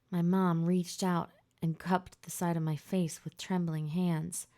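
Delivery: usual speaking voice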